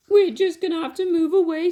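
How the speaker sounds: high, loud wailing lament; hammed-up weeping mother; mock sob. Mother Mouse despairing after escape, loud high wail. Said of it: "sobbed Mother Mouse".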